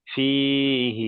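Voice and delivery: ফিহি recited slowly, hi held long; drawn-out fihi. Shown as a demonstration. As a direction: stretch ফিহি like Quran recitation. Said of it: "যেমন". (ধীরে টেনে ফিহি)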